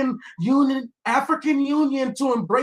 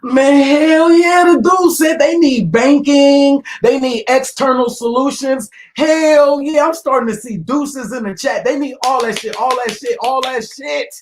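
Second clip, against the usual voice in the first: mock hype shout; 9 dB louder than usual. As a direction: yell it out like a fake fan hyping the clip. Mock hype over the clip.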